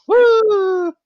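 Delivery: loud high whoop; excited cheer. Real, not acted. Cheering a repeat vacation.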